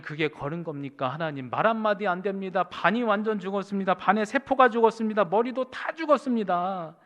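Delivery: loud, high protest building in force; resentful complaint. Retold or imitated. Stricken pastor railing at God after his first step, resentful outcry. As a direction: rail at God in bitter complaint. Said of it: "원망하기를".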